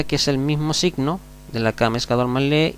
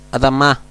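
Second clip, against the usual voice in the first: Adamá said louder on the final syllable; stress on last syllable; 5 dB louder than usual. Shown as a demonstration. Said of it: "Fíjense bien".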